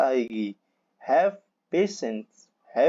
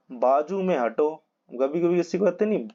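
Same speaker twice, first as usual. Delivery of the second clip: ordinary delivery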